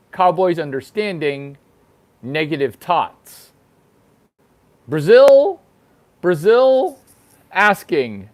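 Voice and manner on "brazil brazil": calling out. Pretend. Calling out to Brazil.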